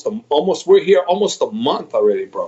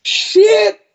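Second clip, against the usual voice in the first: high, louder burst of dismay; exclaimed curse; 4 dB louder than usual. Retold or imitated. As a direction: blurt it out, way up. Coach retells reaction to broken toe.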